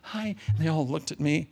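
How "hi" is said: soft, timid little greeting; gentle hi. Retold or imitated. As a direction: slip in shyly, barely above a breath. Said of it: "just gently".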